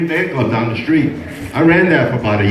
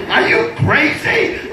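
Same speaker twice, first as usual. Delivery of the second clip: slowed, breathy with laughter; Are you crazy drawn out slowly through a laugh; laughing disbelief; 10 semitones higher, slower than usual. Retold or imitated. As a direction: let the laugh break through the question. Husband yelling at his wife.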